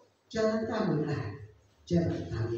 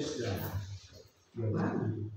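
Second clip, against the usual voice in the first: ordinary talk, nothing marked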